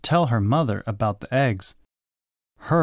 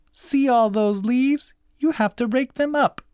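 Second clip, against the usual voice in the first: bossy cousin; 13 semitones higher than usual. A narrator's reading. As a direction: order them about in a high voice. Bossy cousin giving orders.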